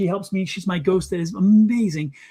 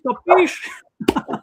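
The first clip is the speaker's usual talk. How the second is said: Capiche? pitched much higher in a playful put-on voice; mock Capiche; 10 semitones higher than usual. Medium teasing Lisa after the warning, playful high Capiche. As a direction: tease her with a high, sing-song Capiche. Repeats higher, then laughs.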